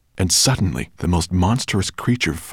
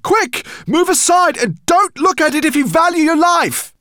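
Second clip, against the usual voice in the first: urgent shout; 6 dB louder than usual. Acted. Man shouts warning to dragon.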